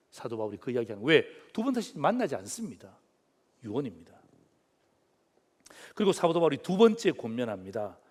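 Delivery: ordinary talk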